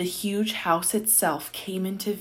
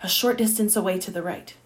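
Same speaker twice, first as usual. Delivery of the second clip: plain reading voice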